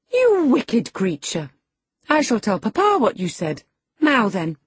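high, loud, affronted protest; indignant girl. A narrator's reading. Girl threatening the lad with Papa, indignant high voice. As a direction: scold with girlish outrage. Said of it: "tears sprang into her eyes with indignation".

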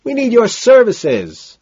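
high, loud, stretched-out call; drawn-out holler; crying back. A narrator's reading. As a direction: shout your request across the club. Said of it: "Price cries back".

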